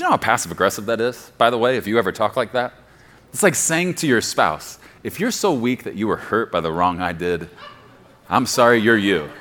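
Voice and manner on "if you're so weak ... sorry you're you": mock sarcastic apology. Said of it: "That's what you're actually saying".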